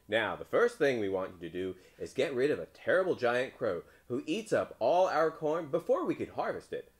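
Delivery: loud, insistent villagers; townspeople's demand. A narrator's reading. Townspeople begging rid of a giant crow, loud voice. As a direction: press the request loudly. Townspeople give the frog a task.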